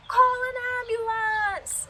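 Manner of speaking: high, loud, drawn-out wail for help; screaming child. Retold or imitated. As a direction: imitate a kid yelling at the top of his lungs. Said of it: "screaming at the top of his lungs".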